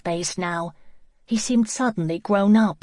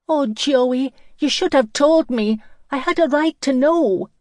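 high, loud, wounded protest; upset reproach; 8 semitones higher, 7 dB louder than usual. A narrator's reading. Lizzie reproaches Joey for keeping the secret, upset. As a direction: cry out at being kept in the dark.

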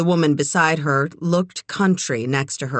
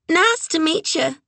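much higher, undeveloped little voice; 12 semitones higher than usual. A narrator's reading. Beulah is introduced to Kit, speaking in a high voice. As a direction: pitch it way up, like vocal cords that never grew up. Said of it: "Her voice was high".

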